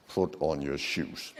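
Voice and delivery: low, slow, soft through tight jaws; quiet menace with the jaws pressed together; calm but aggressive. Shown as a demonstration. Staff claiming to be calm, low slow tight-jawed order. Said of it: "I said like this".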